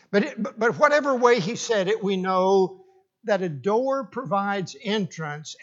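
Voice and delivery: usual speaking voice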